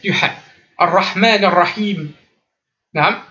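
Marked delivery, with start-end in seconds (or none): 0.8-2.1 Ar-Rahman Ar-Rahim, a light r flowing into the ha; calm recitation; discreet ra. Shown as a demonstration. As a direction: keep the r discreet. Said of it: "il est tout discret".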